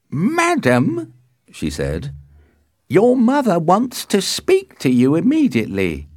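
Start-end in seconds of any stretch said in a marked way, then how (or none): 0.1-1.1 very high-pitched call; high nurse voice, summoning. A narrator's reading. Nurse calls her mistress. 2.9-6.1 high, loud nurse voice; insistent, high-voiced servant; nurse summoning. A narrator's reading. Nurse summons her mistress.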